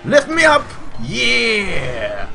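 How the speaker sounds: high, loud whooping yell; excited cheer. Real, not acted. Player cheers his jump in the game, excited yell. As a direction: yell it out in triumph.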